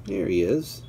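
ordinary delivery

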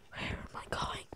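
breathy whisper to himself. Real, not acted. Gamer lost in the woods, whispering to himself. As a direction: hush it, lost and unsure.

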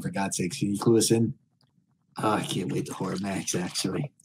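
normal talking voice

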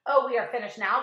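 loud cry of despair; loud, panicked wail. A narrator's reading. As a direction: cry out loudly that all is lost. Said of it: "cried Miss Spider".

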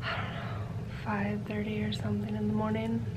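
tired late-night whisper. Real, not acted. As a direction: hush it out, unvoiced.

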